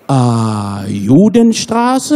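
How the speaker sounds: long, slow question rising at the end; puzzled German driver. Acted. Taxi driver mishearing the street name, puzzled. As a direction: mishear it as a baffled German cabbie. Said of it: "אז הוא לא מבין".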